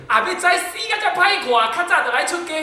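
high, loud exasperated outburst; angry outburst. Retold or imitated. Master Qinghong's angry words to the ghost, loud exasperated outburst. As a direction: blurt it out in a fit of temper. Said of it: "他只是一句气话".

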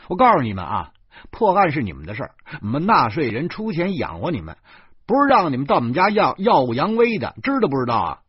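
loud, fast, high rant; furious tirade. A narrator's reading. Taxpayer furiously lecturing the police, loud and fast. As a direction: let them have it. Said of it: "我怒气冲冲地说".

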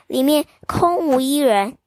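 ordinary delivery

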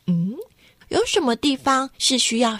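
ordinary delivery

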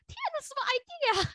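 high, alarmed "天哪，什么idea？"; inner panic. Retold or imitated. Thinking to herself after the mistake, high alarmed panic. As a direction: freak out inside at the slip. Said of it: "然后就说".

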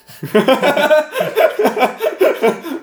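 loud high cackling laugh; high burst of laughter. Real, not acted. Laughing at own pun. (大声高亢地笑)

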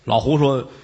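ordinary delivery

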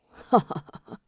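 pleased little cackle; gleeful laugh. A narrator's reading. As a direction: laugh at the trap sprung. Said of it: "哈哈笑道". (暗自高兴地笑)

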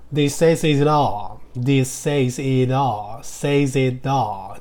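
"This says it all" run together smoothly, words linked; linked reading. Shown as a demonstration. Teacher showing students how to link the words, slow and linked. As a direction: glide "This says it all" as one linked phrase. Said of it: "你要这样把它连起来念才可以".

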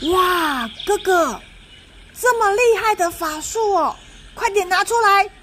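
put-on high excited little girl; excited little sister. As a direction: cheer big brother on. Little sister cheers brother.

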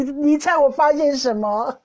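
ordinary talk

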